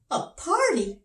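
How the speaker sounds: high, puzzled king; king's voice. Acted. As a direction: ask it like a startled little king. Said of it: "asked the king".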